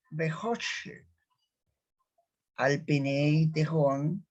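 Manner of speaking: slow phrase-by-phrase recitation with long pauses; slow Hebrew reading. Shown as a demonstration. Teacher reading the second verse, slow deliberate recitation. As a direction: draw out the vowels, pausing after each phrase. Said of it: "lo leemos como".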